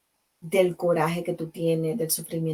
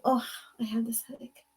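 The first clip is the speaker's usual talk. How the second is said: softer, strained put-on moan; pained headache moan; 4 semitones higher, 6 dB quieter than usual. A complainer moans about her headache to a friend. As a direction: groan it like it hurts. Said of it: "people that always have a headache".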